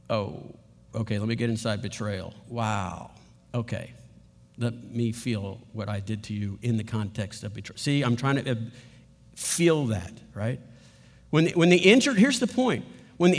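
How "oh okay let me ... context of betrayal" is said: softer and lower, taking in the word betrayal; quiet, inward realization; soft reflective self-talk. Pretend. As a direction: murmur it as if the hurt is only now landing. Imagined apologizer absorbing hurt, slowly.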